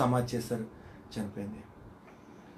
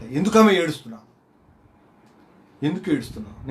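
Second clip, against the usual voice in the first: loud pleading question; 4 semitones higher, 7 dB louder than usual. Retold or imitated. Missionary asks weeping mother.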